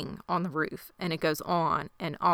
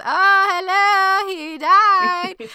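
high, loud, drawn-out sarcasm; mock the obvious, hammed up; incredulous mock exclamation; sarcastic; 13 semitones higher, 8 dB louder, much slower than usual. [name] mocking Lottie's logic.

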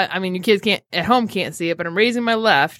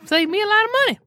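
hillbilly impression; 8 semitones higher than usual. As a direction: put on a squeaky hillbilly voice. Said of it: "You sound like, um, the, uh, hillbilly doll".

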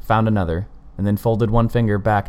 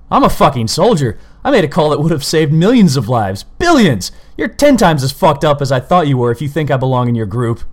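roared, much higher and louder; furious roar; 7 semitones higher, 8 dB louder than usual. A narrator's reading. Colin raging at the killer, roaring. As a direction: rage at him with everything. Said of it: "Colin roared".

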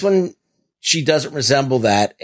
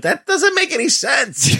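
exasperated shout; 13 semitones higher than usual. Retold or imitated. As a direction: yell it in disbelief. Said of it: "I'm like".

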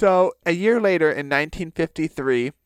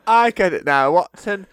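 higher, jolly put-on British voice; mock British accent; 7 semitones higher than usual. Crick urges Watson on with the experiment, hammed-up British accent. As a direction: chirp it like a hammed-up British gent.

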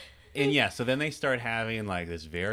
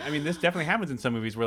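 ordinary talk, nothing marked

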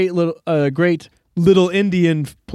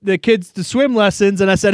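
ordinary delivery

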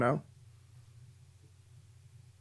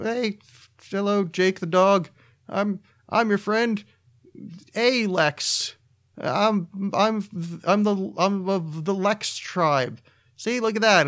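much higher and slow, halting cartoon extra; weird cartoon voice; 6 semitones higher, slower than usual. Podcaster playing himself on Adventure Time, high goofy voice. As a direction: drag it out, pitched up and goofy. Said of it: "when I get all weird and up-pitched".

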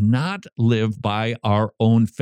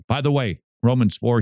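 ordinary talk

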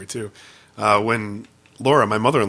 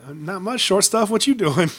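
flirty comeback; 7 semitones higher, slower than usual. Pretend. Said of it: "I would have played along".

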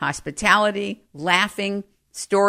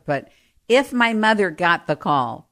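ordinary delivery

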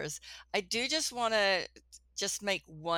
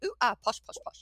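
mock posh British; 6 semitones higher, faster than usual. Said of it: "and sometimes be like".

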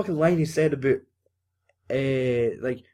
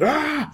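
high hammed-up scream; short high shriek of pretend fright; mock scream; 14 semitones higher than usual. Podcaster acting out film's frightened runner, mock scream.

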